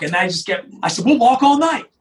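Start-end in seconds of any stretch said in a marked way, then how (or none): 1.0-1.9 rallying cry. Retold or imitated. Sheriff promises the marchers, rousing call. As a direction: fire up the crowd.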